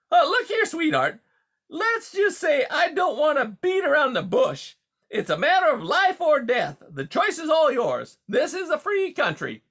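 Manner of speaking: high, loud huckster patter; brash, pushy beaver. Acted. Beaver presses the mice to move for his dam, brash and pushy. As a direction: sell the scheme hard, breezy and loud. Beaver makes veiled threat.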